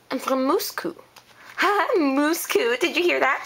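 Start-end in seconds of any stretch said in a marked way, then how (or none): 0.1-0.9 put-on Moscow said with a Russian accent. Photographer showing her accent on request, Russian accent. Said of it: "Say it in your accent". 2.0-2.7 drawn-out Russian-accented Moscow, imitated; mock Russian accent. Girl making fun of the photographer's accent, mock Russian accent. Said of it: "but she says Moscow".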